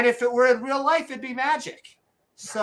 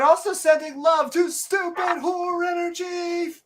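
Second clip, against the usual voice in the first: excited shoutout; 10 semitones higher than usual. Real, not acted. Enthusiastic shoutout to donor.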